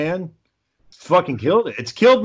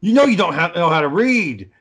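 raised, louder teasing jab; a light put-on taunt; mock taunting; 4 semitones higher, 4 dB louder than usual. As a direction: needle him like a schoolyard bully. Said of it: "He's like".

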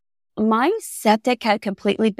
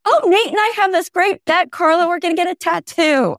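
much higher, eager mock-husband voice; excited husband; 8 semitones higher than usual. Husband calls his swamped wife about a tattoo bet, gushing and high. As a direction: gush like a kid with a great plan. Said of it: "And he's".

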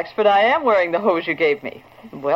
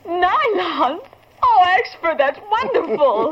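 high, thrilled gasp of joy; delighted surprise; 12 semitones higher, slower than usual. Acted. Secretary thrilled at the nylons gift, high delight. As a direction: gush over the gift.

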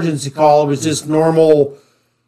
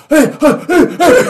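frantic yelling; 10 semitones higher than usual. Retold or imitated. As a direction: yell to stop a rolling car. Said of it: "Sergeant Maxwell is like".

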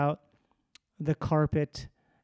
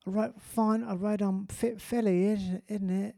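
hammed-up British accent, pitched higher; mock British accent; 3 semitones higher than usual. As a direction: play a posh Brit chatting up a lady, in a British accent. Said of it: "You did a really good Jamaican accent".

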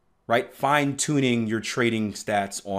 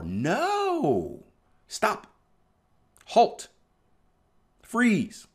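emphatic stop; 11 semitones higher, much slower than usual. Real, not acted. Coach halting traders' urge to do more.

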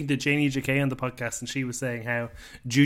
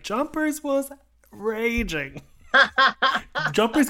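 high, slow, laughing through it; 10 semitones higher, 4 dB louder, slower than usual. Real, not acted. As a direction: laugh while saying it. Amused at a queen's fury.

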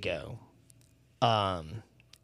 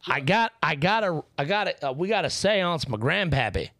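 higher, hammed-up hillbilly accent; 3 semitones higher than usual. Joking about grandpappy in a hillbilly accent. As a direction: play a moonshine-loving hillbilly accent.

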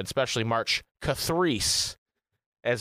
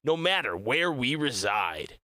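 hammy announcer, stretching the phrases slowly; Tang commercial voice; 2 semitones higher, slower than usual. Acted. Streamer selling a fan event to listeners, cheesy commercial voice. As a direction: sell it like a cheesy drink advert. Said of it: "I was doing my Tang commercial voice".

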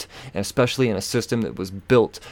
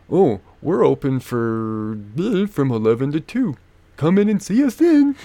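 mocking employee; 5 semitones higher than usual. Mocking the McDonald's staff's closed-door excuse. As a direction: parrot the closed-door brush-off.

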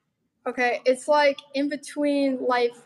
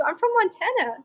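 high, marvelling lilt; amazed disbelief; 9 semitones higher than usual. Real, not acted. Telling a friend she got into neuropsychology, amazed and high. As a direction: sound like you can't believe your luck. Marvelling at her own acceptance.

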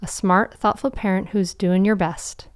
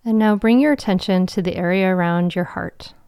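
guided meditation. Acted. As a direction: guide them into calm, with long pauses.

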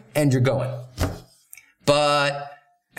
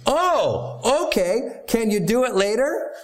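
much higher, bright hopeful voice; mock eager follow-up ask; hopeful asker; 8 semitones higher, slower than usual. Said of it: "So they say".

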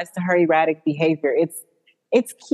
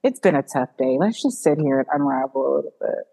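mock soothing; 7 semitones lower than usual. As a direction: lull her like a fake therapist. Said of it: "She's like".

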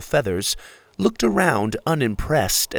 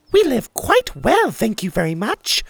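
much higher, squeaky mouse retort; 12 semitones higher than usual. Acted. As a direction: snap back like a tiny mouse. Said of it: "Maria the mouse retorted".

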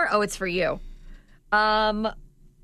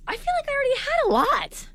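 squeaky high-pitched voice. Pretend. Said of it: "you guys all talking in high voice".

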